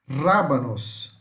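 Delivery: slow "Rábanos" with the first syllable strongest; stress on accented syllable. Shown as a demonstration. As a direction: hit RÁ in "Rábanos", rolled R. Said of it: "onde vamos falar a sílaba mais forte".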